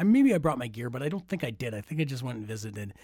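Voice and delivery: ordinary talk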